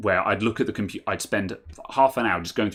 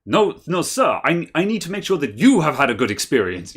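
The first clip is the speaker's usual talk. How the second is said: high, louder insistent scammer voice; phone scammer; 7 semitones higher, 5 dB louder than usual. Retold or imitated. South Park scammer talking to another scammer, insistent. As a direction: imitate an over-earnest call centre scammer. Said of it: "two scammers talking to each other".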